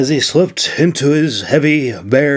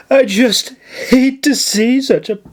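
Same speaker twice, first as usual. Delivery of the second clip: higher, gasping put-on grief; breathless fake distress; 8 semitones higher than usual. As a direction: work up the fake tears. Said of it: "Nora gasped breathlessly".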